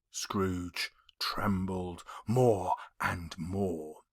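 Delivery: hushed narrator whisper. Acted. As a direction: breathe it out in dread.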